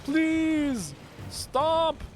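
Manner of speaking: very high squealed plea; panicked pleading. Real, not acted. Player begging an attacker to stop, panicked squeal. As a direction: beg in a long, high-pitched wail.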